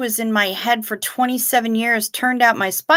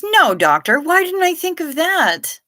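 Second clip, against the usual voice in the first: sarcastic; 8 semitones higher than usual. Retold or imitated. Sarcastic reply to doctor's advice.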